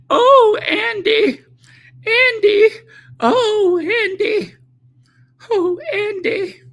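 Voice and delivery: very high falsetto cry, mimicking a woman; shrieky parody of a swooning lover; mock yelling. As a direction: wail it in a hammed-up falsetto. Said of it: "While she's yelling, "Oh, Andy".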